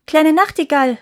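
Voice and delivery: high, loud call. A narrator's reading. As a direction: call up to the little bird. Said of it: "rief die Küchenmagd". (hoch und laut gerufen)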